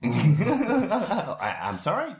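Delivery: hammy broken English small talk; fake English. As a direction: play a phony English-conversation teacher. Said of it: "エセ英語をちょっと喋り始めた".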